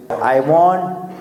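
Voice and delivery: ordinary delivery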